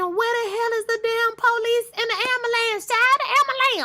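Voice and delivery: high, exasperated demand; frantic impatience. Retold or imitated. Viewer demanding to know where the ambulance is, frantic. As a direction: voice it like you're yelling at the screen. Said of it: "the whole time I'm thinking".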